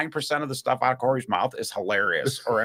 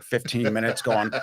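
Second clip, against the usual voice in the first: normal talking voice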